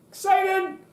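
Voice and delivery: high-pitched lift of excitement; bright burst of energy; excited. Shown as a demonstration. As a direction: pop it up high with pep. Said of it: "your voice goes up a little bit in pitch".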